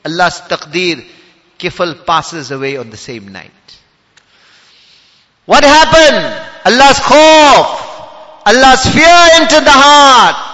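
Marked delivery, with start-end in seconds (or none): 5.4-10.5 high, loud, slow exclamations; full-force preaching. Real, not acted. Preacher explaining the sinner's change of heart, full-force preaching. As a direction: thunder each phrase out, drawing it long.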